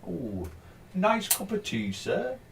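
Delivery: teasing imitation of posh British speech; posh British accent. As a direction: ham up a posh British waiter serving tea. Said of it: "we just all talk posh".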